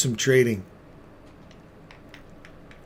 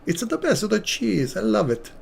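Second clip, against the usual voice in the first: silly high voice; 9 semitones higher than usual. Pretend. Playful cheese voice after laugh.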